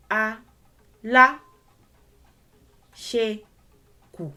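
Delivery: A, la, ṣe, kù split apart, each on its own tone; sounding out syllables. Shown as a demonstration. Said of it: "whatever I say here has to align".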